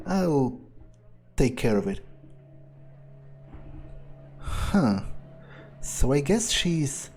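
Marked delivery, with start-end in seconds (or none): none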